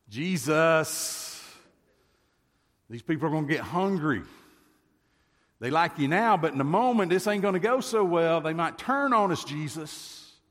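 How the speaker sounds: urgent, nervous plea that the crowd may turn; anxious disciples. Acted. Disciples warning Jesus, raised voice.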